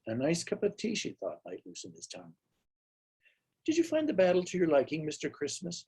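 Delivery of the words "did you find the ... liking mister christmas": light, airy question rising up high; asked lightly. A narrator's reading. Housekeeper lightly asking her employer about the battle, airy. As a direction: toss the question off casually, a touch higher. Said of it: "she asked lightly".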